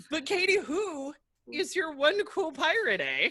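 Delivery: lightly Canadian pirate voice; a pirate line that comes out Canadian, eh; Canadian accent. Pretend. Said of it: "That sounded Canadian".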